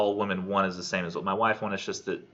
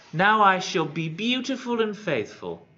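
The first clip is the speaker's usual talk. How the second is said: high, slow put-on female voice; transformed hag; 10 semitones higher than usual. Hag announces her transformation to the knight, slow high grand voice. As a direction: pronounce it slowly and grandly, pitched high.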